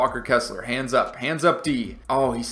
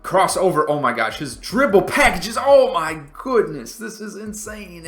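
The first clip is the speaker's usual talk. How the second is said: higher and louder, amazed at the moves; awed excitement; 5 semitones higher, 5 dB louder than usual. Real, not acted. Gamer reacting to a rival's crossover, amazed and excited. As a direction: marvel out loud at the dribble.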